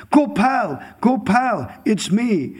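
high, loud eager call to a friend; calling out to his beloved, overjoyed; excited calling out. Retold or imitated. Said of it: "And he says".

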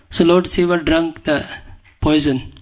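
normal talking voice

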